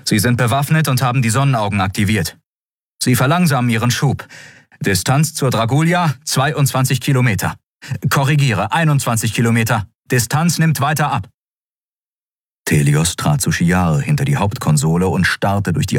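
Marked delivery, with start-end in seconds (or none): 0.0-11.3 quick, higher-pitched urgent report; brisk bridge officer; officer's report. Acted. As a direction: report tensely to the admiral.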